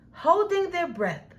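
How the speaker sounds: ordinary delivery, nothing marked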